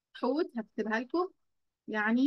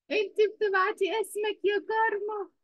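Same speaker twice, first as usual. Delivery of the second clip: much higher pitch, stressing كارما; raised, exasperated repeat; 9 semitones higher than usual. Real, not acted. Teacher repeating herself to Karma, higher and exasperated. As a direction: call her out again, pitched high. Teacher correcting a pupil twice.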